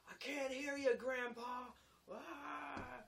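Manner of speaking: high, loud mocking holler; taunting shout. Laborer two flights down to carpenter, taunting shout. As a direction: call up the stairs to needle him.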